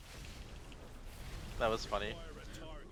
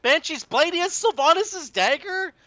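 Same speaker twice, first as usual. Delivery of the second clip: shocked disbelief; 5 dB louder than usual. Real, not acted. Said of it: "shocked at enemy's gear".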